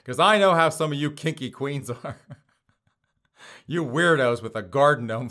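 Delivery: ordinary delivery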